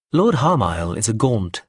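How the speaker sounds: ordinary delivery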